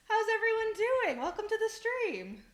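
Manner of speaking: high, bubbly hostess greeting; excited welcome. Real, not acted. Streamer welcomes a raid of new viewers, excited.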